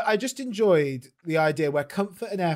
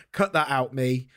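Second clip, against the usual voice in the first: normal talking voice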